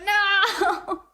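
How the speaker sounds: a high, laughing protest. Retold or imitated. Protesting friends' teasing with a high laughing no. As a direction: burst out a laughing no at the joke. Mock horror at fans' joke.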